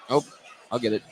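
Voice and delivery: ordinary talk, nothing marked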